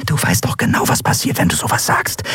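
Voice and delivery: hushed, pleading whisper; urgent whisper. Acted. Friend hushing the captain, an imploring whisper. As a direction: whisper it so fate can't hear. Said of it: "sahen ihre Freundin beschwörend an".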